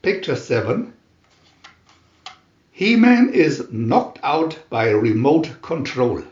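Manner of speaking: ordinary delivery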